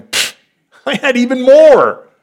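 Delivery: God laughing off our small hopes; lightly voiced God scoffing eagerly; playful God. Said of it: "God's going".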